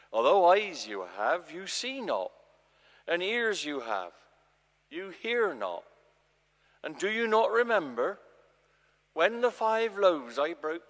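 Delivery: Jesus scolding disciples who will not understand; rebuking Jesus. Acted. Jesus chides his disciples, loud and exasperated.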